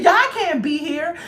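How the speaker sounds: high, slow mock officer voice.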